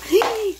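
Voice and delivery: sudden high gasp of alarm; sharp gasp. Real, not acted. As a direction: catch your breath in surprise. Spills the seasoning.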